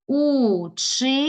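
"Учитель" in three long, separated syllables; split into syllables. Shown as a demonstration. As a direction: stretch у, чи, тель slowly with pauses. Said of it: "Поділи на склади".

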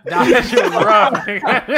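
loud laughing exclamation. Real, not acted. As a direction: call it out through big laughter. Group cracking up over the injury.

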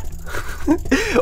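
high burst of laughter. Real, not acted. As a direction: crack up at the kill. After killing opponent. (yüksek sesle kahkaha)

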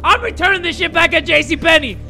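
loud, high-pitched rant; indignant shouting. Real, not acted. Player refusing to marry the pursuing ghost, shouting. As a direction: yell your refusal at full volume. Yelling back at game character.

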